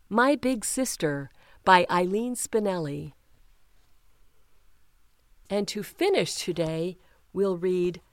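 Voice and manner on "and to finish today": ordinary delivery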